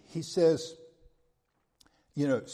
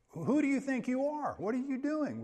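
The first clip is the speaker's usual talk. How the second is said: raised pitch, incredulous challenge; indignant demand; 8 semitones higher than usual. Retold or imitated. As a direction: confront him with disbelief. Angry king confronting Abner.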